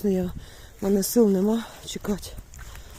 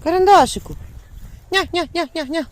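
high, loud call across the yard; calling the dog; 9 semitones higher, 6 dB louder than usual. Real, not acted. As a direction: call your dog from far away. (голосно кличе собаку)

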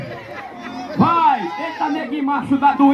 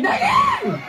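very high, laughing shriek of the name; slower than usual. Real, not acted. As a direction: squeal it out in shocked glee. Rider falls, announcer shrieks. (grito agudíssimo, rindo)

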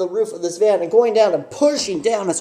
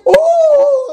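very high, loud shocked oh; 8 dB louder than usual. Retold or imitated. The driver seeing them tangled up, very high loud shocked oh. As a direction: burst out a stunned oh. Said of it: "He's like".

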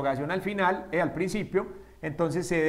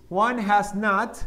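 Juan has not, every word slow and distinct; careful teacher demo; slow model sentence; slower than usual. Shown as a demonstration. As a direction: dictate it slowly. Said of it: "teacher models sentence".

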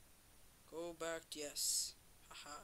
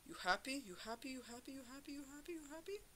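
each you happy pitched higher until it squeaks; singsong taunt rising with each repeat; mocking taunt; 9 semitones higher, much faster than usual. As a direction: keep asking you happy, climbing higher every time. Taunting a cell in game.

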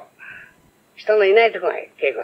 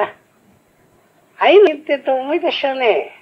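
ordinary talk

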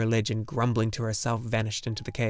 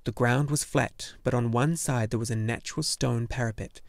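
usual speaking voice

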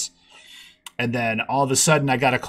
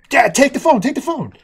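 much higher, urgent panicky plea; panicked; 13 semitones higher, 8 dB louder than usual. Retold or imitated. Teen begging dad to take the contest call, panicked. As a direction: shove the phone at dad in a panic. Too young, panics and hands phone.

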